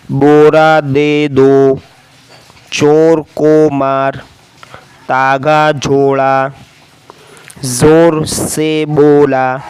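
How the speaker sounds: धीरे धीरे पढ़ना; very slow reading. Shown as a demonstration. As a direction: read each sentence very slowly so pupils follow. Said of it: "मैं धीरे धीरे पढ़ूँगा ताकि आपको समझ में आए".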